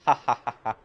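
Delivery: loud gloating villain cackle, then settling; evil villain laugh. Acted. Villain cackles at Damru before attacking him. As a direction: cackle over the trapped boy.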